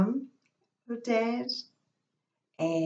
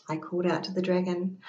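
ordinary delivery, nothing marked

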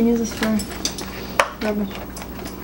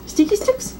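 high playful; 11 semitones higher than usual. Real, not acted. Playful repeat of dish name.

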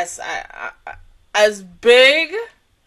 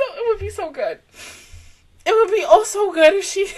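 tearful words with a wobbling voice; crying; 5 semitones higher than usual. Real, not acted. Fan moved to tears by a hoped-for reunion, happy crying. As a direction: cry through the joy, tears breaking the words. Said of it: "I don't even know why I'm crying right now".

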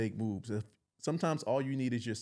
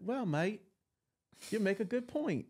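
light mock British accent, pitched higher; imitating a friend's British accent, higher voice; 9 semitones higher than usual. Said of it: "in her British accent".

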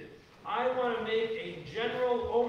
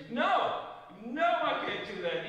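emphatic retold refusal; 4 semitones higher than usual. Pastor retells refusing a last-minute wedding, emphatic and raised. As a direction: replay turning the request down, pitched up and firm.

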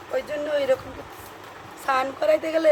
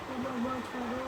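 hushed plea; 11 dB quieter than usual. Real, not acted. Baby asleep, speaking softly.

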